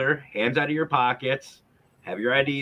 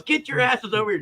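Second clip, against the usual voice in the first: imitating a cop hollering at dealers; retold cop yell; 12 semitones higher than usual. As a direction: bark it like a cop starting a chase. Cop yelling while giving chase.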